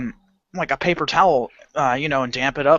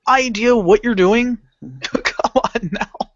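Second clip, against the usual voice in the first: incredulous laughter; 7 semitones higher than usual. Retold or imitated.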